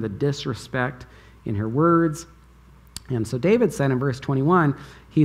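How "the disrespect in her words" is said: ordinary talk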